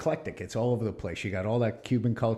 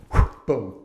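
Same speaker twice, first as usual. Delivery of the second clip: loud whoosh and boom; mock whoosh sound; 11 dB louder than usual. Punching out of the Navy, loud sound effect. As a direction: blow out of there with a bang. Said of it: "sound effect".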